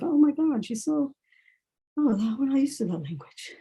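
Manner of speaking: mock dismayed onlookers. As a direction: mimic their hushed disbelief. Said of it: "looking at me like".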